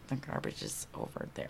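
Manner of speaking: imitated hushed, near-whispered aside; sheepish cop. Second cop quietly helps her, sheepish hushed voice. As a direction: murmur it softly, feeling bad for them. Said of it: "Like, clearly felt bad".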